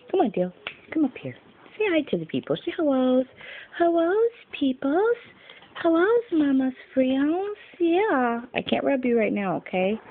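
high, sing-song pet voice. Real, not acted. Talking to the family dog.

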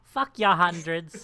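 loud, slow, drawn-out refusal; mock ungrateful beggar. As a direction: parody an outraged beggar, high and laughing.

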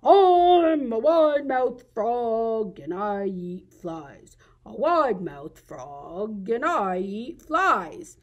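slow, stretched-out frog voice; wide-mouthed frog. Acted. Frog looking around calls out his boast, drawn-out frog voice. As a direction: drawl it with a big swooping start. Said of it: "and he said".

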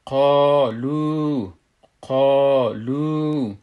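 قالو slowly, both vowels held long; madd pronunciation. Shown as a demonstration. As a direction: stretch qā and lū in قالو. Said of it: "قالو نمیگیمش".

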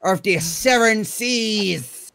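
slow, hammed-up pirate captain. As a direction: drawl it like a raft pirate. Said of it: "my pirate voice".

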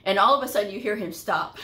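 ordinary talk

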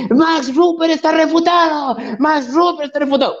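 sneering imitation of the other debater; mocking copy of opponent. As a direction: parrot the opponent's gloating to ridicule it. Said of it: "Yo no estoy gritando así, no sé para qué usted me copia".

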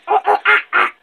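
short bursts of monkey calls; monkey impression. Shown as a demonstration. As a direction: hoot and screech like a monkey. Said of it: "So this is the monkey".

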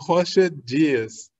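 slow try at Rocha Dias with a Portuguese h sound and zh; attempted Portuguese. Shown as a demonstration. Host trying the guest's surname, slow careful attempt. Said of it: "I'll try in Portuguese".

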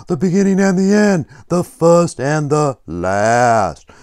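loud, theatrical puppet scripture reading; Blub Blub. Pretend. Puppet shares a promise from Revelation, loud theatrical proclaiming.

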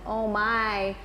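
ordinary delivery, nothing marked

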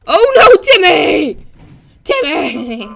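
loud, high wail for a lost character; mock-anguished cry. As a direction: cry out his name in hammed-up despair. Crying out for game character Timmy.

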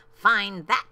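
a hint of a gloating bird; mildly scornful dare; sneering. A narrator's reading. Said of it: "sneered the bird".

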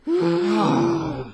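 high startled shriek; startled scream. Real, not acted. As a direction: scream in sudden fright. Jump scare in horror game.

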